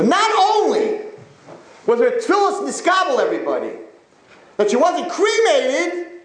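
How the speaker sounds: soaring sermon punchline; impassioned preaching climax. Real, not acted. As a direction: proclaim the miracle at the top of the range. Preacher's climax of the miracle story.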